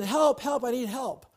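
put-on high, loud cry for help from a frightened boy; panicked boy crying out. A boy trapped on a burning roof, high panicked cries.

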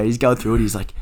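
ordinary talk

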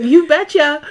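high, loud put-on cheerful voice; mock you betcha. Hammed-up mock voice answering a friend. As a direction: belt out a cartoonish you betcha. Mocking an over-eager yes.

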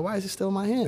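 high, puzzled question. Retold or imitated. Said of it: "I'm like".

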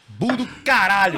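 loud, slow triumphant shout; excited shout. Real, not acted. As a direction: cry out in triumph. Exclama com entusiasmo. (grito empolgado e lento)

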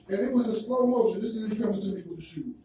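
usual speaking voice

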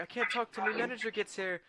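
high-pitched cartoon voice; acted movie character. Retelling Mister Krabs' line, high acted voice. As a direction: quote it in a high, put-on voice.

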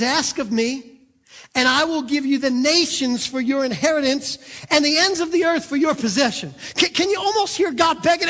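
loud, driving sermon delivery; full-force preaching. Acted. Preacher pleading for the congregation to ask. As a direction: preach it out to the crowd.

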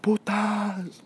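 put-on high, stretched drunken cry; mock drunk shout, high pitched; drunk yell. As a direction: mimic the wasted friend hollering it out. Drunk friend yelling at party.

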